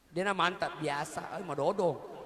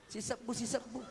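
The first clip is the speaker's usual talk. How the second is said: mock mutter; 3 semitones higher, 8 dB quieter than usual. Mocking small donation chant.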